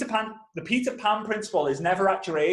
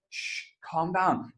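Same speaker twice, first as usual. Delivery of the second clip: slow, softer shushing; urgent hushing; 5 dB quieter, slower than usual. Retold or imitated. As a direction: hush someone in a cinema. Hushing dad in cinema.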